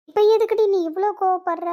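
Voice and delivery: alarmed retort. Acted. Friend shouts back, startled by her sudden anger.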